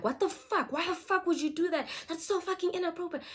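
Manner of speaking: high-pitched indignant retelling, little extra volume; imitated screaming. Boyfriend scolding the girl, retold as high mock screaming. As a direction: mock-scream the outraged questions. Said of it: "he starts screaming at her".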